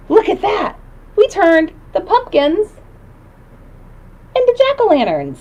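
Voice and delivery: high delighted reveal; excited. Acted. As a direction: show it off with delight.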